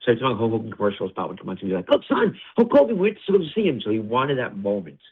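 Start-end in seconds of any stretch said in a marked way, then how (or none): 1.9-3.8 imitating a thrilled dad, high and raised; high-pitched mock excitement, louder; excited father. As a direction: call out to your son in a high, excited voice. Voices father excited at TV spot.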